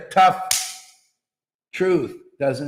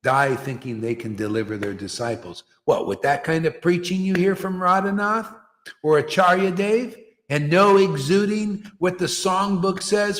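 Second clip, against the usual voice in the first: usual speaking voice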